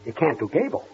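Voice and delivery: low, soft incredulous question; stunned disbelief. Acted. Boss to the mimic who can't do Gable, quietly stunned. Stunned at the news, asks twice.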